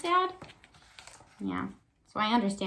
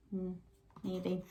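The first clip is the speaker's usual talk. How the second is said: ordinary talk, nothing marked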